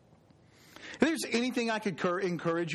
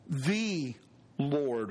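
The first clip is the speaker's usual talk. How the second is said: "the" singled out, then a pause; stress on THE; 5 semitones lower than usual. Shown as a demonstration. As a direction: set "the" apart before "Lord". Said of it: "emphasize each word".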